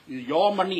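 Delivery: Your money said loud and high; emphatic call. Real, not acted. Panel chair tells the public it is their money, loud. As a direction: press Your hard at the public.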